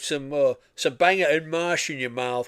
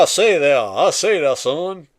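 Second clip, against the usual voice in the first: loud mock West Texas drawl; 3 semitones higher, 8 dB louder than usual. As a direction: boom it out like an old Texan. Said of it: "mine's more West Texas".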